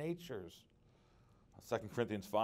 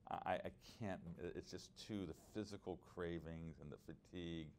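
softer, pleading prayer to the Lord; quiet retold prayer; 4 semitones lower, 8 dB quieter than usual. Preacher reliving his prayer to God for deliverance, softly pleading. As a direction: hush down as if praying alone.

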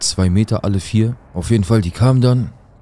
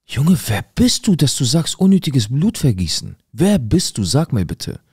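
much higher, indignant outburst; outraged; 6 semitones higher than usual. Retold or imitated. Teen retelling the thug's threat, higher and indignant. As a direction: fire it back, incensed and disbelieving. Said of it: "Ich bin durchgedreht".

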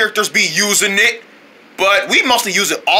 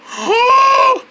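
high, drawn-out comic gasp; astonished gasp. Acted. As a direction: gasp as if stunned by a compliment. Reacting to being called handsome.

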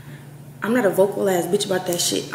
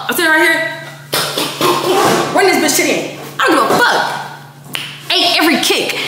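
laughing; 7 semitones higher than usual. Real, not acted. Laughing while retelling the fight.

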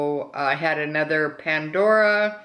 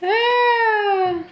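high squeal; 6 dB louder than usual. Real, not acted. Reacting while opening the box.

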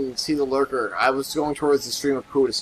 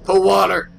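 higher and louder exclamation; excited call-out; 5 semitones higher, 7 dB louder than usual. Real, not acted. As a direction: call it out loudly. Calls out at the game.

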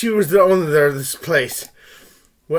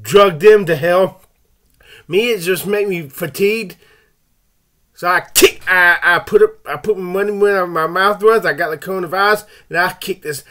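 ordinary talk, nothing marked

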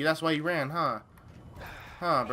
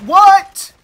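a sudden, much higher, much louder "What?"; shocked yelp; 19 dB louder than usual. Real, not acted. Gamer yelps in shock at a sudden kill. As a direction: jolt out a high yelp of disbelief.

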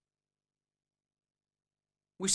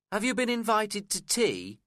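cross; 11 semitones higher, 4 dB louder than usual. A narrator's reading. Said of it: "she asked me crossly".

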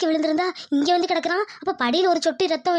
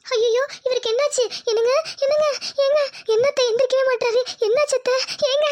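panicked wife; 5 semitones higher than usual. Acted. Wife finds husband fallen.

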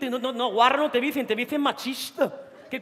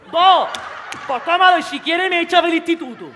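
loud mock-defiant teenager; bratty student yelling at the teacher; defiant student shout; 5 semitones higher, 8 dB louder than usual. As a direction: shout it back like a cheeky pupil.

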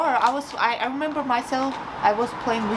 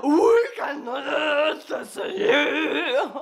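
laughing; 4 semitones higher, much slower than usual. Real, not acted. Laughing at blurry camera.

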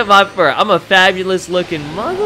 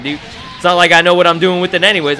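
ordinary talk, nothing marked

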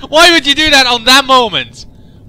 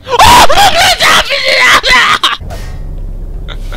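long high shrieking wail; high horror-game scream; panicked scream; 14 semitones higher than usual. Real, not acted. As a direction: scream in terror, high and drawn out.

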